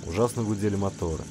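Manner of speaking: usual speaking voice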